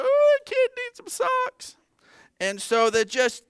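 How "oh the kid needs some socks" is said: much higher, pitched-up mock tender mom voice; high mock-tender pity for a kid without socks; tearful mom. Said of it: "She's just like".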